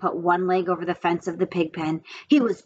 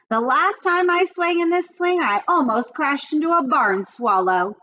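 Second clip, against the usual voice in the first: yelling; 6 semitones higher than usual. A narrator's reading. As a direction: call it down from the hayloft. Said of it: "he yelled".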